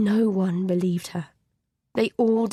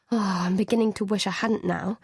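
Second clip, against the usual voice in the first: ordinary delivery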